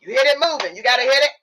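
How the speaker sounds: high, loud push to get going; hyped urging. Real, not acted. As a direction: pump the listeners up. Emphatic motivational command.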